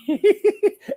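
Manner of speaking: high delighted laugh breaking out; real burst of laughter. Real, not acted. Host laughing to viewers about meeting Waddy, delighted. Recalling finding Waddy in pub.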